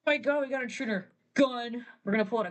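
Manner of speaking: mock panic; alarmed. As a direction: sound the alarm. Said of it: "Then he's like".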